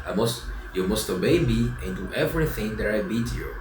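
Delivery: usual speaking voice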